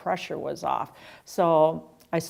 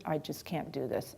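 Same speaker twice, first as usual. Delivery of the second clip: softer whine; 3 semitones lower, 10 dB quieter than usual. Retold or imitated. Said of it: "I was whining a lot".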